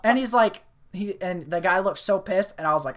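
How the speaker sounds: ordinary talk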